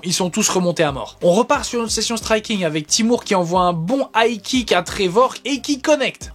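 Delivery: ordinary talk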